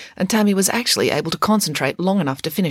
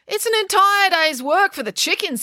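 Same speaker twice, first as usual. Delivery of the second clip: high, indignant outburst; 14 semitones higher than usual. A narrator's reading. Mum defending the chickens to unbothered Tammy, indignant. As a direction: protest it high, aggrieved.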